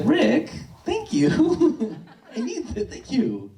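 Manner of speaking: high, breaking into laughter; laughing thanks. Real, not acted. Teacher thanking the kind student, laughing through it. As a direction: thank him while cracking up.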